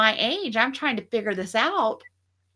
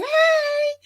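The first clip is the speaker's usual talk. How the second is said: very high, louder hey across a crowd; calling out; 4 dB louder than usual. Retold or imitated. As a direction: sing out hey to catch their eye.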